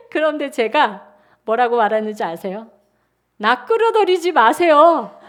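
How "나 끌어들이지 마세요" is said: high, loud laughing protest; laughing refusal. Retold or imitated. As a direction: refuse through bursts of laughter. Wife refuses husband laughingly.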